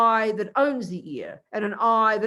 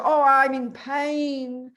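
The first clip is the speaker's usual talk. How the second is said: drawn-out, higher whiny "I'm in pain"; mock patient complaint; 4 semitones higher, 5 dB louder, much slower than usual. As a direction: play the hurting patient, stretching it out. Acting a patient at the doctor.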